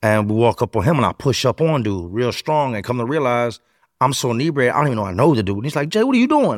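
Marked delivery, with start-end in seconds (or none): 5.9-6.6 high-pitched, startled victim; imitating a man suddenly recognizing his robber; surprised protest.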